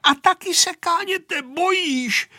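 put-on high-pitched childish taunt; shouted taunt. A narrator's reading. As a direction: yell it mockingly in a high voice. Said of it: "křičel Vojta, až zčervenal".

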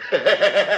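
short real laugh. Real, not acted. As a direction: burst out laughing. Smích. (krátký smích)

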